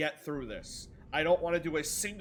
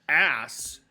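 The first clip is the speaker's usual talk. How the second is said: mock jeer; 8 dB louder than usual. Mock chat reaction.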